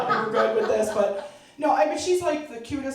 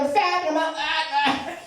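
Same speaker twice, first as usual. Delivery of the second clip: higher, slow half-sung retort; sing-song defiance; 8 semitones higher, slower than usual. Retold or imitated. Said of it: "I'm like".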